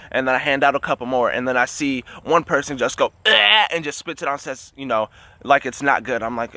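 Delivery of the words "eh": short, higher, louder "eh" of disgust; mock gag of disgust; disgusted retch. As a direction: imitate spitting out something foul. Imitates someone spitting it out.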